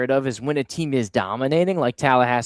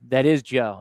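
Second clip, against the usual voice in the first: ordinary delivery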